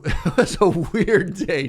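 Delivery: loud, slow, laughing through the words; helpless amused laughter. Real, not acted. Laughing as story ends.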